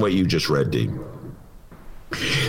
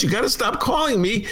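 ordinary delivery

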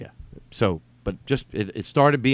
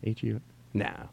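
doubtful self-talk; 12 dB quieter than usual. Acted. Reader rejecting the right answer, muttering softly. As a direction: dismiss the idea under your breath. Reader dismisses own guess.

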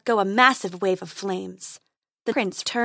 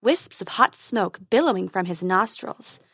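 ordinary delivery, nothing marked

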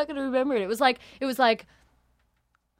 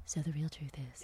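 hushed mock off-mic secret; very soft, secretive whisper; confidential whisper; 12 semitones lower, 15 dB quieter than usual. Pretends the mic is off.

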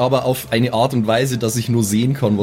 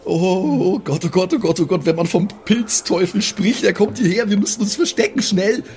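panicked; 7 semitones higher than usual. Acted. Character panics at approaching monster.

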